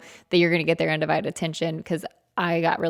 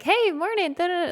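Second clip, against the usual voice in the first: high-pitched imitation of morning chatter; mock chattering hello, pitched way up; chirpy morning greeting; 13 semitones higher than usual. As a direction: sing out a greeting, much higher. Acting out chatty morning entrance.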